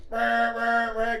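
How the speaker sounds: loud, high beeping honk; car alarm imitation. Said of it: "retold car alarm again".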